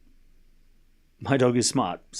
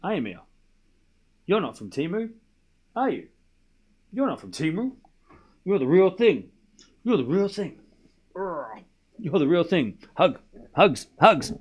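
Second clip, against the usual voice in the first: tender pet talk; 3 semitones higher than usual. Real, not acted. Reassuring the dog, baby talk.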